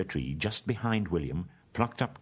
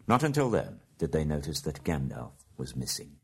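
usual speaking voice

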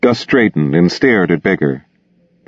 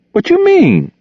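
very high-pitched indignant question; challenged, indignant; 3 dB louder than usual. Acted. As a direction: squeak it out as a challenge. G H challenged after being insulted.

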